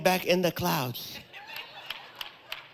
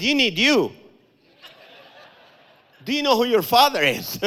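higher, louder street-preacher push; mock preaching; 6 semitones higher, 5 dB louder than usual. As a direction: corner him with a zealous sermon. Preaching at the Jesus actor.

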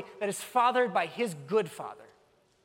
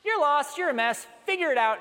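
curt, higher, louder brush-off; dismissive lecture; 6 semitones higher, 4 dB louder than usual. Retold or imitated. Christians brushing off a lost person, curt and loud. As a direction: dismiss them sharply. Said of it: "do we sometimes tell people".

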